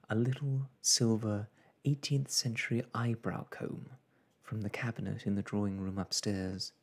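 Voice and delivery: ordinary delivery, nothing marked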